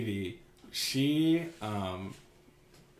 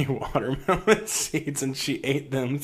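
ordinary talk, nothing marked

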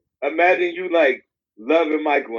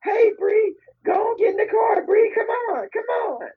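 very high falsetto coaxing; light, airy falsetto, beckoning someone over. Acted. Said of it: "talking in the [name] voice".

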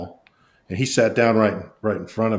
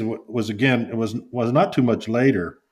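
usual speaking voice

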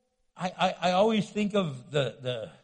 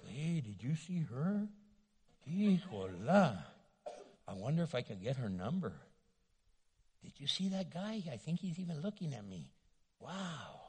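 distracted whisper-like thoughts; 6 semitones lower, 11 dB quieter than usual.

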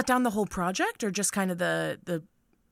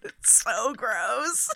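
ordinary talk

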